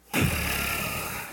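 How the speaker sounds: long unvoiced sigh of reluctance; heavy weary sigh. Real, not acted. Pastor sighing when wife calls about trash. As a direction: exhale like the last thing you want.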